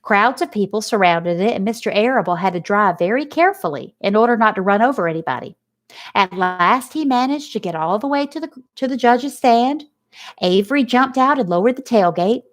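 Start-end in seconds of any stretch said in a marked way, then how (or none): none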